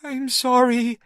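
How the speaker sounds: put-on high, small child's voice; a small boy saying sorry; a little boy, ashamed. A narrator's reading.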